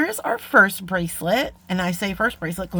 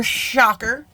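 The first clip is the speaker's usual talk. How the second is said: higher, punchy sarcastic SHOCKER; playful self-mocking exclamation of "Shocker"; 4 semitones higher, 4 dB louder than usual. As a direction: announce "Shocker" as if amazed. Sarcastic aside about forgotten ruler.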